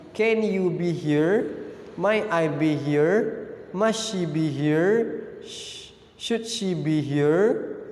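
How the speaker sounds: each English question drawn out slowly for the class; slow model sentences. Shown as a demonstration. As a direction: read the examples slowly like a teacher. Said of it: "Jadi, tambahkan be". (kalimat contoh dibaca pelan-pelan seperti guru)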